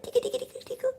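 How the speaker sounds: playful tickle. Shown as a demonstration. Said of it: "or tickle it".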